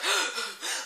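gasping for air, acted; mock asthmatic gasp. Girl without her inhaler, mock gasping for breath. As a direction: imitate a child's breathless gasps. Acts girl's asthma attack.

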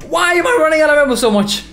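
fast, high, loud complaint; exasperated yell. Real, not acted. Gamer out of ammo yelling fast in exasperation. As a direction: rattle it off in shrill frustration. Frustrated at empty gun.

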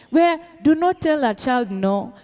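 usual speaking voice

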